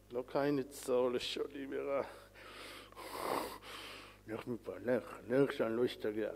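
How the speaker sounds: soft, deliberate self-talk against anger; slow, quiet restraint. Acted. Preacher voicing a man resisting temptation, slow and soft. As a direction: hold yourself back, softly and slowly. Acted restraint, telling off urge.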